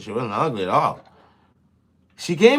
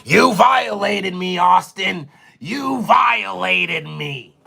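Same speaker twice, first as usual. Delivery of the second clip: slow, higher, loud hammed-up accusation; mock outrage; 5 semitones higher, 4 dB louder, much slower than usual. Accusing Austin of violating him, hammed-up slow shout. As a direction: drag it out like a wronged victim.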